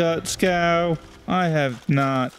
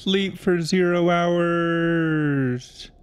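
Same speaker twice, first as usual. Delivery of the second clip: drawn out; much slower than usual. Pretend. Reading menu option slowly.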